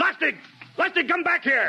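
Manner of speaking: high, urgent call after him. Acted. Captain calling after a lieutenant running off. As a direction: shout to stop a running man.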